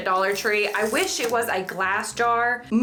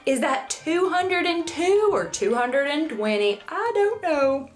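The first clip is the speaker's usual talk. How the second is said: higher, slowed puzzled reading; mock confusion; 6 semitones higher, slower than usual. Crafter mimics herself misreading numbers, mock confusion. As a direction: squint out the numbers in hammed-up bafflement.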